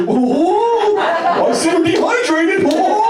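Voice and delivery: very high cartoon character voice; Goofy. Goofy to a dad on a stretcher, high cartoon hooting. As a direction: hoot it high like a cartoon mascot. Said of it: "Goofy puts you on a stretcher".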